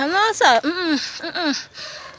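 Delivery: high-pitched mock refusal, trailing into soft hums; acted refusal. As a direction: refuse high, then hum it softly shut.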